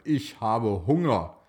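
plain reading voice

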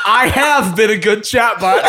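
hammed-up self-praise through laughter; laughing read-out. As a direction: proclaim it while cracking up. Cracking up while reading chatbot's self-praise.

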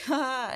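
a sharp, high, loud imitated "Ha!"; retold burst of laughter; mock single laugh. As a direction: bark out one mocking laugh.

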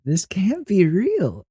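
high, drawn-out disbelief; incredulous. Real, not acted. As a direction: stretch it out high, unable to believe it. Reacting in disbelief to bizarre clip.

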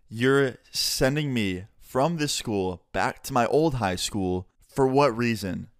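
slow, halting, incredulous retelling; acting out stunned disbelief, word by word; retold disbelief. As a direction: spell it out slowly in disbelief. Retold disbelief at being sent back.

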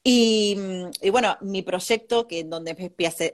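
ordinary talk, nothing marked

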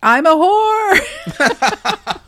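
high, loud, hammed-up announcement; drawn-out, laughing parody of showing off; desperate attention-seeking. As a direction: belt it out slowly in a mock-needy squeal. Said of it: "it just seemed desperate because everything she was doing was about".

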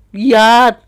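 a sudden loud burst on one word; loud exclamation in a rant; emphatic shout. Real, not acted. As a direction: bark it out to make the point. Ranting about tiring sex to viewers.

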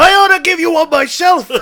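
very high, loud yell; hammed-up outraged shout; furious threat. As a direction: explode at the witness. Detective threatens witness in rage.

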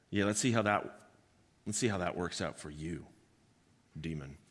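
low, soft taunting; quiet taunt. Acted.

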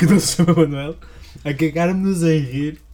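wheezing with laughter; laughing. Real, not acted. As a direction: laugh through the words. Laughing repeat.

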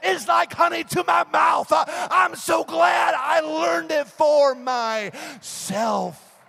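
high, loud, driving sermon shout; full-force preaching. Real, not acted. Preacher urging people to taste God for themselves, full-force preaching. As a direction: preach it at a shout, stretching key words.

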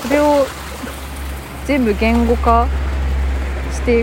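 speaking up over traffic noise. Real, not acted. Walking beside busy road traffic. (車の音に負けない大きな声)

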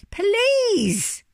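high, loud, drawn-out plea; exasperated shout. A narrator's reading. Interrupted bear from underneath the hippo, exasperated shout. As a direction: shout to be heard at last. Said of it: "he shouted".

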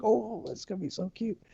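high, soft imitation of giggly gushing; giggly excitement. Homeowner retelling his glee at his cute house, giggly and excited. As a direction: gush in a small high voice, acting out your own giddiness. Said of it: "I got, like, giggly".

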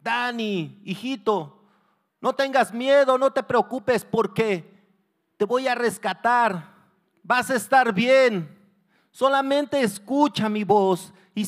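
loud, high reassuring shouts; father's urgent, encouraging yell; shouting to his son. Acted. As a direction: call up to your child through the smoke. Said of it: "le empezó a gritar a su hijo".

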